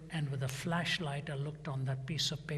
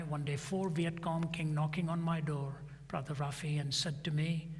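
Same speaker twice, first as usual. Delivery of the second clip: ordinary delivery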